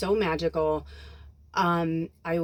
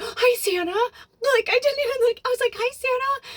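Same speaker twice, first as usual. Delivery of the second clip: put-on childlike excitement; 4 dB louder than usual. Greeting Santa like a kid.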